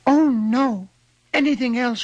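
very high cry of dismay; desperate cry. A narrator's reading. Queen begs little man for her baby, desperate cry. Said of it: "cried the queen".

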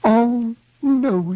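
very high, loud wail; weeping. A narrator's reading. Queen hears no new name was found, weeping. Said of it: "she wept".

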